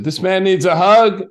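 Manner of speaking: high, loud, slow call across the restaurant; booming public announcement to everyone eating. Retold or imitated. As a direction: announce it to the whole room, slowly. Calling out in a restaurant.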